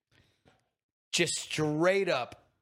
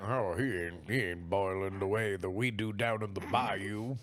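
put-on Cajun accent; 8 semitones lower, 5 dB quieter than usual. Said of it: "you'd be like".

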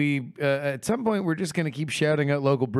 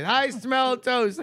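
mock last words; 7 semitones higher, much slower than usual. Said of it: "if I die from a stroke".

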